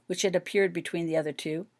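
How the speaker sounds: plain reading voice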